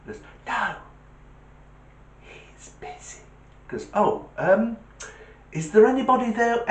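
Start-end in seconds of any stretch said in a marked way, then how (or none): 0.5-3.2 put-on tiny hushed child on the phone; little whispered voice. Little child answering a caller, whispering. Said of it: "a little voice answered". 3.9-6.7 high, sing-song caller voice; talking to a child. Acted. Caller asking a child for a parent, high-pitched.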